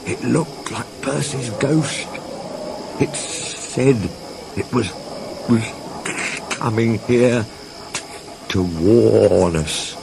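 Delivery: slow, halting and shaky. A narrator's reading. Toby warning Thomas of the ghost, halting and shaky. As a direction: stammer it out, frightened. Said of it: "said Toby in a shaky voice".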